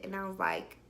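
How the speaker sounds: ordinary talk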